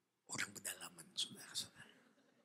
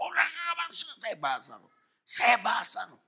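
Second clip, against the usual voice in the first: high, loud outburst; urgent cry; 10 semitones higher, 8 dB louder than usual. Shown as a demonstration. Preacher showing a cry of prayer under attack, urgent shout. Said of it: "sebut bahasa roh".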